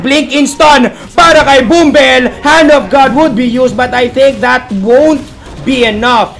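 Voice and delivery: loud, high, drawn-out esports call; excited play-by-play. Real, not acted. Caster calling a blink-in stun on Bumbel, excited drawn-out shouting. Live game commentary at force.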